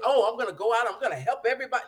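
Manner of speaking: a preacher mocking showy eagerness, overdone and loud; mock overeager. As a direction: ham up the over-eager helper. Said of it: "Not that you".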